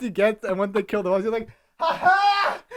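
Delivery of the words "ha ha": high shouted laugh bursts; Tom Cruise meme laugh. Retold or imitated. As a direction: cackle loud and triumphant like the meme. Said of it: "He does the meme".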